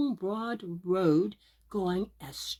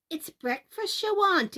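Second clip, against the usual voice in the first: mocking wife; 10 semitones higher than usual. Ogre's wife answers Jack.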